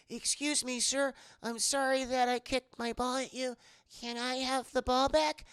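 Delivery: put-on high, polite little boy voice; little kid voice. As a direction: play a shy small kid asking nicely.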